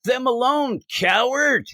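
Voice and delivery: mock-heroic call, pitched well up; heroic challenge. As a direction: call out a high-pitched comic-book challenge to the villain. Acting out hero confronting villain.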